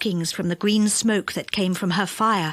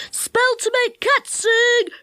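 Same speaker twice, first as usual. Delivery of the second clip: gnome crying wares; 13 semitones higher, 3 dB louder, slower than usual. Acted. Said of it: "cried another gnome".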